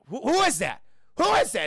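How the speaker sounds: high, loud startled challenge; spooked, pitch climbing on the repeat; alarmed. Acted. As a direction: call out in rising panic. Startled man challenges unseen voice.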